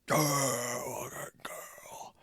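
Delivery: whispered imitation of a crowd roar; long breathy mock cheer under the breath; pretend fan cheer. Imitates a drunk fan's crowd cheer.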